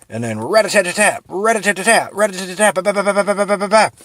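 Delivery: loud high rat-a-tat machine-gun mouth sounds; spray the room with mock gunfire; gunfire noises. Narrator acting out the war's gunfire, loud mock shooting.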